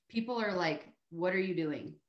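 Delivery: ordinary talk, nothing marked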